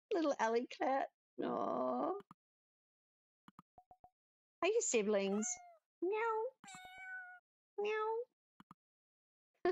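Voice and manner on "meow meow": high, tiny kitten meow; cat meow. Acted. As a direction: mew like a small cat. Cats answer with meows.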